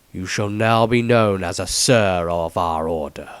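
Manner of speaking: a defeated lord grants the title, low and slow; aged Patriarch. Acted.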